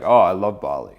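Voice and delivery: "Oh, I love Bali." with the tune falling at the end; downward inflection. Shown as a demonstration. Coach showing the grounded way to answer her. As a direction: say "Oh, I love Bali." and let it drop. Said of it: "downward inflection".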